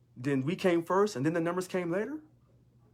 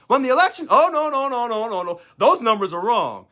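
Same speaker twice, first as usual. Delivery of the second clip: higher, louder sarcastic no no no; mock denial; 6 semitones higher, 7 dB louder than usual. Leader mocking critics who deny his election win, sarcastic and loud. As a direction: parody their panic at his win.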